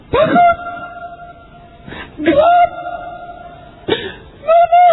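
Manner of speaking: very high-pitched acted shrieks; terrified screaming. Dying proud man screams in fear of the dark, terrified shrieking. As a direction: shriek out in panic, one word at a time. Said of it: "dia teriak".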